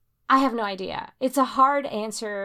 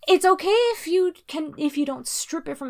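flustered defense; 9 semitones higher, 7 dB louder than usual. Real, not acted. Flustered defense after challenge.

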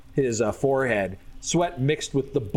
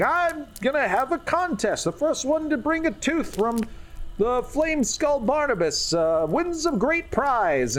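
high, showy barker voice; hammed-up crowd pitchman; announcing a contest; 12 semitones higher than usual. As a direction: call it out like a carnival barker. GM voices player's contest call.

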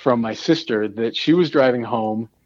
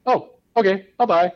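mock easy acceptance; 7 semitones higher than usual. Imagined meek acceptance of apology.

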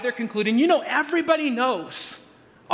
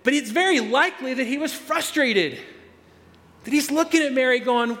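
ordinary delivery

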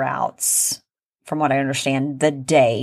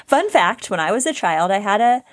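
usual speaking voice

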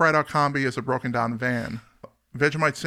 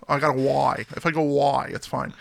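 normal talking voice

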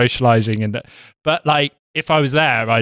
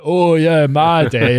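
hammed-up Yorkshire accent, higher and louder; Four Yorkshiremen impression; 5 semitones higher, 4 dB louder, much slower than usual. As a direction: do a Monty Python Yorkshireman. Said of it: "Monty Python style Four Yorkshireman".

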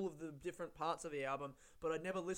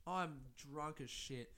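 ordinary talk, nothing marked

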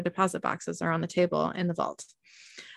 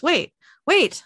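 high, louder attempt to stop someone; raised, alarmed interjection; urgent protest; 9 semitones higher, 7 dB louder than usual. A narrator's reading. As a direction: cut in urgently, voice jumping up. Character tries to stop another.

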